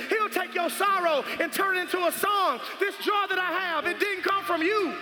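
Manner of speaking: building, insistent preacher's cadence; full-force preaching. Real, not acted. Preacher telling wounded listeners God restores, building full-force preaching. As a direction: climb steadily to a high, declaring finish.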